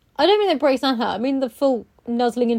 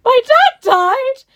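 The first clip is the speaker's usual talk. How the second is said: high, slow, tearful wail; imitating crying; 11 semitones higher, 7 dB louder, much slower than usual. Client at the hair basin, mock crying. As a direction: wail it as if bursting into tears. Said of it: "and burst into tears".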